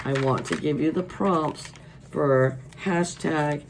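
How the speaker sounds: ordinary delivery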